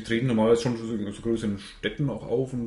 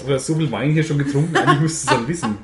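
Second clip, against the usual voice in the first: teasing through laughter, louder; laughing; 2 semitones higher, 7 dB louder than usual. Real, not acted.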